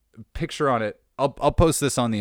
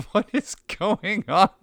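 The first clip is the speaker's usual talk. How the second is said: laughing, higher and slowed in disbelief; 9 semitones higher, slower than usual. Real, not acted. Baffled by a fan's gift shirt, laughing disbelief. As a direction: let the laugh carry the question.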